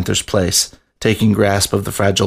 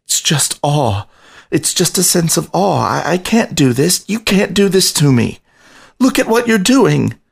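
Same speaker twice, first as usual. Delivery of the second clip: voice cracking; 8 semitones higher than usual. A narrator's reading. Rat begging the lion to stop, voice cracking and climbing higher. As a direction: let the voice break more with each plea. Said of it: "spoke in a voice that cracked freely".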